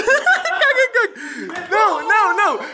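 very high, giggly "caga, caga" through laughter; nervous laughing. Real, not acted. To viewers after the scare, high nervous laughter. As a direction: laugh off the fright while still scared. Laughing off his fear on camera.

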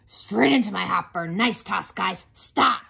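loud, booming truck character; garbage truck voice. Acted. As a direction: boom it like a hungry truck. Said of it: "garbage truck voice".

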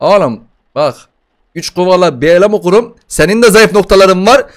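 usual speaking voice

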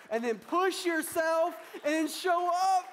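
slow, high, loud preaching push; rally the church to push; preacher's loud, slow charge; loud, slow exhortation. Real, not acted.

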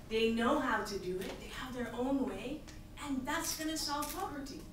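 ordinary talk, nothing marked

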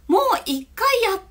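put-on quick, high child's voice; child who's done it. Child telling teacher they did it already, high and quick. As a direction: voice a child piping up that it's old news. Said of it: "っていう友達は".

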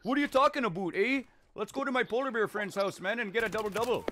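high, loud hammed-up Canadian accent. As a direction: play an over-the-top Canadian guy. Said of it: "He's speaking Canadian".

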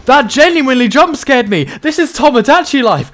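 high, loud startled panic after a jump scare; genuinely scared. Real, not acted. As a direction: blurt it out shaken and rattled. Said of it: "I genuinely just got scared".

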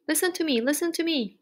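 high-pitched cry without much volume; mild mock scream. A narrator's reading. Goose demands Wilbur listen, mild scream. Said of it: "screamed the goose".

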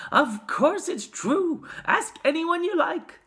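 high, loud, slow insistence; emphatic high-pitched cry. A narrator's reading. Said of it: "cried all four of the old people at once".

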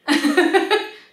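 high, loud laughing burst; storyteller's laugh. Real, not acted. Storyteller amused by his own joke, loud laugh.

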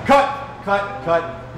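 loud, high shouts of cut, easing down each time; director yelling cut. Acted. Director halts the booyah singers with loud shouts. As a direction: stop the take with a hammed-up yell.

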